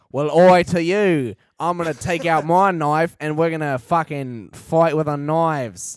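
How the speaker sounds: loud, slow mock British tough guy; loud slowed mock British brawler; tough guy reply. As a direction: answer the challenge like a pub brawler. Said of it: "then the other guy's like".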